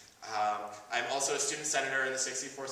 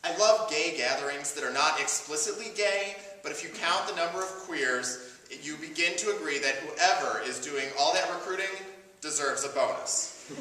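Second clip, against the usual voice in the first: emphatic, projected spoken-word delivery; prepared monologue; 4 semitones higher, 6 dB louder than usual. Acted. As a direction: deliver it as a measured speech to a crowd.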